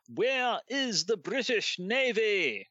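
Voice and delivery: high, slow, drawn-out plaintive cry in a hammed-up Victorian accent. Host closing the hoax news story with its plaintive cry, hammed-up Victorian accent, high and slow. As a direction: wail out the question like an old-fashioned newsman in despair. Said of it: "doing the really quite stereotypical Victorian accent".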